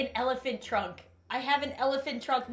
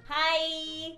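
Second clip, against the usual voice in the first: usual speaking voice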